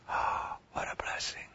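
whispered in wonder; a hushed marvel; awed whisper. Real, not acted. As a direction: breathe it in awe. Hushed awe after quote.

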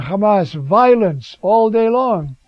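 loud prophet's cry; preacher voicing Jeremiah's public outcry, loud. Cry the warning out loud. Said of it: "I have to cry out".

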